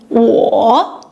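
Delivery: 我 dipping down then rising; third tone demo. Shown as a demonstration. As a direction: show the falling-rising third tone on 我. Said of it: "Notice the third tone, falling, rising".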